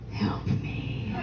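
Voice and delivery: voiceless, hushed cry for help; whispered plea. Acted. Hostage taker begging police at the door, whispered. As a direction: whisper it as a desperate little plea.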